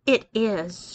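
plain reading voice

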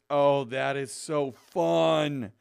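slow, drawn-out gushing; lingering thrilled exclamation; delighted. Real, not acted. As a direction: stretch it out with glee. Discovers sing-along in gift.

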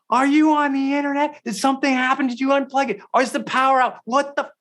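high-pitched frantic yelling at wife; yelling to his wife across the house, high and frantic; retold panicked yelling. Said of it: "I'm screaming".

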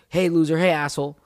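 imitating someone yelling at a passerby; imitated yell. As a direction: call out insults like a street heckler. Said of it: "someone's yelling".

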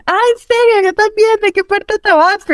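high, thrilled questioning voice; eager stranger from Tabasco. Retold or imitated. A stranger asks the fake Tabasco man where he is from, high and excited.

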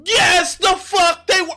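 furious shout. Real, not acted. Streamer yells at the debaters on his stream, furious shout. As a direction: bursts out yelling at the screen in disbelief. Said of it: "Headphone users, I'm sorry".

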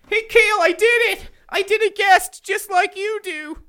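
host thrilled at doing a guest; excited call out. Real, not acted. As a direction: shout it proudly across the room. Host excitedly calls producer.

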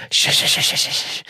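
whispered shh, shh to keep it secret; mock shushing. Comic Texans whispering shh to keep it secret. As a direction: hush everyone like a conspirator.

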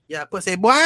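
high-pitched mock Jamaican squawk; Jamaican accent. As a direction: squawk it in exaggerated patois. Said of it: "talking that Jamaican accent".

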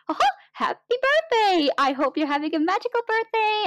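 high-pitched cartoon mouse birthday greeting; Mickey Mouse. Mouse on the phone wishing a guest happy birthday, high cartoon impression. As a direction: squeal it brightly, an admittedly rough imitation. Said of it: "I cannot do his voice, but it was something like".

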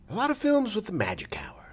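breathy reverent whisper. Real, not acted. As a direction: whisper it like a secret. Dreamy aside about film look.

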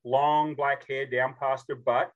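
normal talking voice

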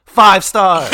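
triumphant shout of the rating; shouted verdict. Real, not acted. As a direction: yell five stars in delight.

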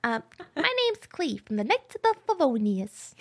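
put-on high young boy voice; pinched little kid voice. Shown as a demonstration. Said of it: "That's the only voice I can do".

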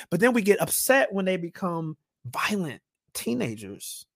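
ordinary talk, nothing marked

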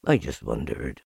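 low, downcast donkey voice; Eeyore, resigned. A narrator's reading.

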